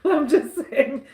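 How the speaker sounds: slow words through a laugh; amused, laughing aside. Real, not acted. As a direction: say it while still chuckling. Laughs at old-as-dirt joke.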